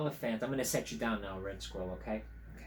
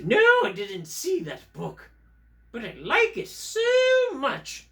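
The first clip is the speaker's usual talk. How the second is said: high, emphatic English puppet voice; English accent; 10 semitones higher, 7 dB louder than usual. Acted. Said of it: "I'm from England".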